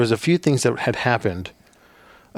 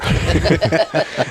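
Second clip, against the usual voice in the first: loud laugh; 7 semitones higher, 5 dB louder than usual. Real, not acted. Laughs at "never" irony.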